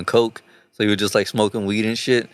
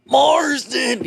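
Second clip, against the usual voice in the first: high, drawn-out mock yell; comic high-pitched call; imitated scream; 14 semitones higher than usual. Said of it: "he just starts screaming".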